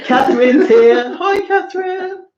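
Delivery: high, drawn-out squealed hello; excited greeting. Real, not acted. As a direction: greet a friend with a laughing squeal. Greeting friend who joins chat.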